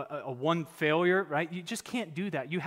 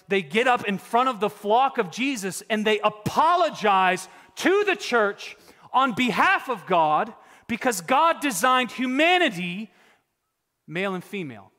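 louder, higher fiery preaching; 5 semitones higher, 8 dB louder than usual. Acted. Preacher rebukes false pastors to the flock, preaching hard. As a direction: drive it home from the pulpit.

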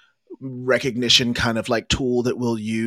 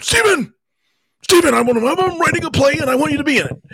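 much higher, louder eager voice; imitating the coach; 6 dB louder, faster than usual. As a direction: voice the coach, high and excited. Imitating theater coach inviting him.

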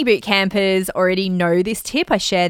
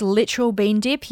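ordinary delivery

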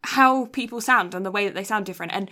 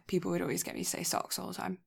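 ordinary delivery

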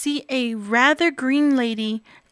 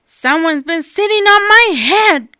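higher, louder whiny complaint; grumbling Baby Bear; 6 semitones higher, 8 dB louder than usual. Acted. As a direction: complain like a cross little bear. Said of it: "grumbled Baby Bear".

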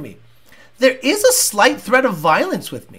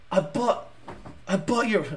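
soft, halting mock hurt; hurt obsessed fan; 10 dB quieter than usual. Snubbed fan who bought the hat, hurt and halting. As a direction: play a rejected fan, stammering softly. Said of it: "that guy's gonna go".

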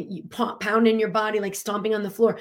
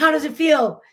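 eager question; 6 semitones higher, 7 dB louder than usual. Retold or imitated. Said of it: "She's like".

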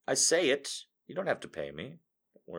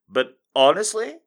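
bright, higher, louder honestly; giddy; 8 semitones higher, 8 dB louder than usual. Real, not acted. To viewers, gleefully showing off his good mood. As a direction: lift it with real glee. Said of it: "Just listen to my voice".

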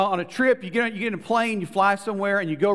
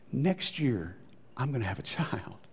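low, quiet disbelief as it sinks in; acted hushed awe; hushed wonder; 6 semitones lower, 7 dB quieter than usual. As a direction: let it land softly, half to yourself. Sarah overhears the promise.

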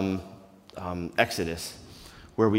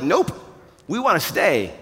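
mock defiant answer; 6 semitones higher, 3 dB louder than usual. What Peter didn't say.

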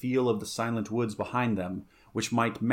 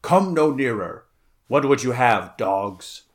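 loud, commanding captain's challenge; raised-voice hero's challenge; stern challenge; 6 dB louder than usual. A narrator's reading. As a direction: warn them off with force. Conan challenges the pirates from galley.

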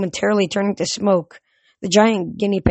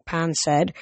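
ordinary delivery

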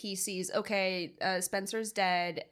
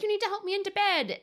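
a much higher, faster, whiny demand; mock demanding mother; 12 semitones higher, 5 dB louder, faster than usual. Mommy interrupting Daddy, high fast whiny demand. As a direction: play the needy mother interrupting. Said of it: "And is like".

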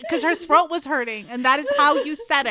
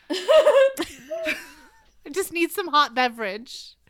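mimicking a friend; 6 semitones higher, slower than usual. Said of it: "you saying hot beverage".

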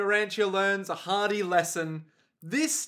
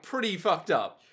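normal talking voice

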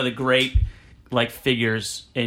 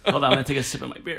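usual speaking voice

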